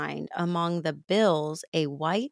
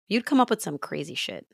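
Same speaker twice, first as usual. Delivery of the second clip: ordinary delivery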